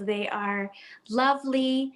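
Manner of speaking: ordinary talk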